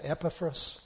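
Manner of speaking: "Epaphras" stressed on the first syllable; right pronunciation. Shown as a demonstration. Preacher checking a name with the congregation, right pronunciation. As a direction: say "Epaphras" as EP-uh-fras, front-stressed. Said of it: "That's the right way to pronounce that".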